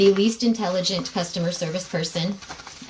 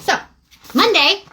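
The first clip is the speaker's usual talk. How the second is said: real heated burst, high and loud; heated, much louder and higher; a little hot; 10 semitones higher, 6 dB louder than usual. Real, not acted. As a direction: punch it out high, worked up. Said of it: "'cause I'm a little hot".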